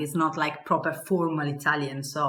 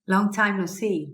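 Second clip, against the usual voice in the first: ordinary talk, nothing marked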